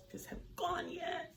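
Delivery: very high, whispered aside. Real, not acted. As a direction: whisper it like a guilty admission. Whispered confession.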